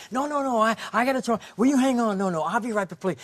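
high, loud, frantic calling out; desperate to keep her from leaving; frantic pleading. Retold or imitated. Stranger on a payphone begging her to wait, high frantic pleading.

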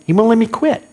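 ordinary talk

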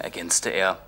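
usual speaking voice

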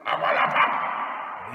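loud, dismissive outburst; rough, drawn-out interruption; robber's shout. Acted. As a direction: brush the objection aside loudly. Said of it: "rief der Räuber Hotzenplotz".

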